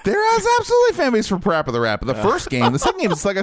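excited. Real, not acted. Excited insistence on fan base.

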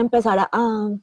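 choking, words stalling; struggling to get words out; choking on a drink. Real, not acted. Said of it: "Por ejemplo, ya me ahogué".

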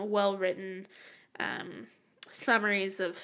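usual speaking voice

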